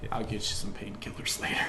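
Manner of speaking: whispered aside. Acted. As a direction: whisper it out of the side of your mouth. Aside to partner.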